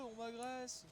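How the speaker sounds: hammed-up squeal of a wronged animal; mock boar voice. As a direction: play the boar crying foul. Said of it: "il est en mode, euh".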